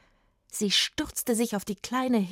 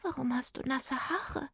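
high, soft, curious fairy; quietly inquisitive fairy; curious little fairy; 5 semitones higher, 7 dB quieter than usual. A narrator's reading. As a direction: ask in a small, high fairy voice. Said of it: "Sie fragte neugierig".

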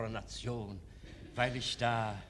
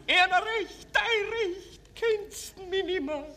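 anguished mother; 3 dB louder, slower than usual. Acted.

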